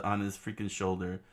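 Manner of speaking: plain reading voice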